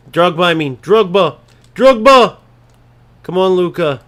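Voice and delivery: building from a shout to a scream; rising excited yell. Real, not acted. Yelling at striker.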